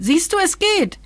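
high triumphant exclamation; shrill cry. A narrator's reading. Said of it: "schrie es schrill".

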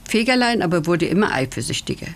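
plain reading voice

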